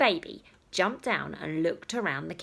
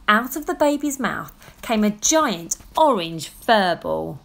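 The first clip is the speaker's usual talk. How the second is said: ordinary delivery, nothing marked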